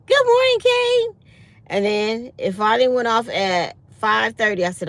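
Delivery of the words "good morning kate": high, loud chirpy alarm greeting; bright high robotic wake-up call; alarm clock voice. Retold or imitated. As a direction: sing it out like a cheerful gadget. Voicing the alarm clock speaking.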